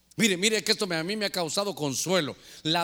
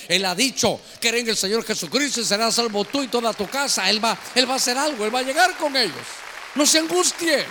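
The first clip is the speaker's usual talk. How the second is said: loud, higher-pitched, urgent reassurance from the pulpit; impassioned preaching; 4 semitones higher, 6 dB louder than usual. Real, not acted. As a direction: build to a forceful, ringing exhortation. Preacher at full force. (predicación a plena voz, enérgica)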